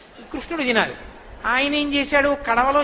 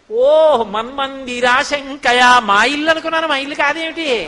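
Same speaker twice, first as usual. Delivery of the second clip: feigned surprise; 2 semitones higher, 7 dB louder, slower than usual. Retold or imitated. Caught Krishna pretending he mistook the house, mock astonishment. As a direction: open on a long 'oh', then play innocent, pitched up.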